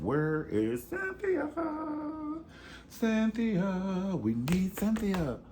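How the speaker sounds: high, drawn-out mock wail; mock plaintive calling. Fan begging for Cynthia's return, mock wailing. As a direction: call for her, stretching the name high.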